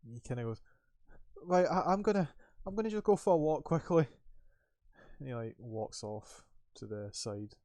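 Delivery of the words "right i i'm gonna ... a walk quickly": high, loud stammered excuse; flustered guard. Acted. Scared guard tells colleague he's walking off, flustered.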